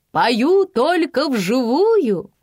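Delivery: high, loud, drawn-out diva proclamation; slow, showy stage announcement; diva announcing. A narrator's reading. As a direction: announce it grandly, stretching the words. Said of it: "объявила дива".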